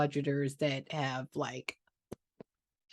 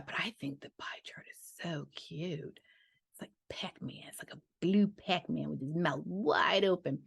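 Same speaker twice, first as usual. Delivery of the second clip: unvoiced, much softer confiding whisper; soft whispered aside about Pac-Man; 5 dB quieter than usual. Real, not acted. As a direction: lean in and whisper the secret. Confiding aside about pie chart.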